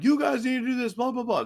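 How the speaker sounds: a hint of an angry coach, half-hearted; imitating yelling coach. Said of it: "they was yelling".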